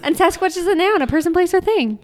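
loud, high-pitched comeback; heated insistence. Real, not acted. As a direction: insist over the top of them. Arguing back over the game.

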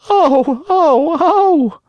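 very high, loud puppet wailing; loud crying wail. Acted. Hungry Pinocchio cries over his lost feet, loud high wail. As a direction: cry out in rising, high-pitched wails. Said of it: "began to scream and cry so loudly".

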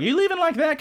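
high, loud mocking mom voice; mom calling out. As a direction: call after her, high and teasing. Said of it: "her mom's like".

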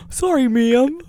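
high-pitched polite apology; imitated caller. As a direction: lift it high, mock-apologetic. Acting callers who mistake him for woman.

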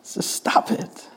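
Just stop it whispered slowly. Shown as a demonstration. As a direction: breathe it softly, almost to yourself. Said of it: "it just doesn't work".

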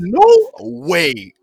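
loud, high "can't believe it"; high-pitched, incredulous outburst; retold disbelief. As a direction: exclaim it like stunning news. Retells his disbelief at the party.

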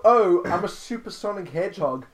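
pretending to be Sonic, lightly voiced; mock Sonic voice. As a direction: brag like a cocky cartoon hedgehog. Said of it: "I just go".

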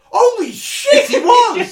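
very high, loud, laughing shout; shocked laughing shout. Real, not acted. Podcaster learning Andrew Garfield was in it, shouting. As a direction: blurt it out in real amazement.

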